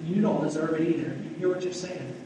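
ordinary delivery

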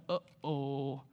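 very low, hushed uh-oh; dread-filled uh-oh. Acted. Zacchaeus is ordered down the tree, quiet mock dread. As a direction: sink down low and small.

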